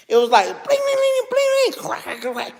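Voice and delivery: high bling of breaking windows, then cracks; imitated glass breaking. Recreating fire sounds for viewers, imitated glass cracking. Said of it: "the worst sound that I could hear".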